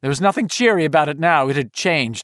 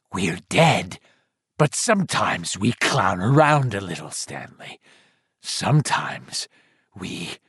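much lower, slow, bubbling ghoul voice; drowned dead voice; 7 semitones lower, 3 dB quieter than usual. Acted. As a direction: gurgle it like a corpse choked with mud. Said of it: "a low, bubbling voice".

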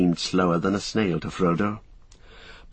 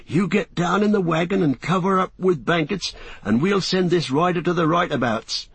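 Sam voice; 8 semitones higher than usual. Acted.

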